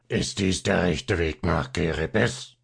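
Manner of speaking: a hint of a rough, husky little man; slow, lightly roughened question. A narrator's reading. Little man asking the way in a mildly husky voice. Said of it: "in rauhem, tief heiseren Ton".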